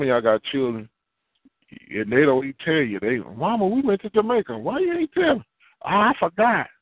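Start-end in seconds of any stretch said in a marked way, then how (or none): 3.2-5.4 much higher, complaining voice; whiny grown child. Retold or imitated. Grown child complaining to mama, high and whiny. 5.8-6.7 put-on child making excuses.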